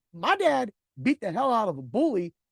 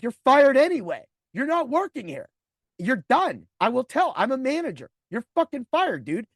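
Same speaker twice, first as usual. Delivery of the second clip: loud, higher, heated firing; angry firing; 6 semitones higher, 5 dB louder than usual. Retold or imitated. Manager firing the rude driver, loud angry rant. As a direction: lay down the law, furious.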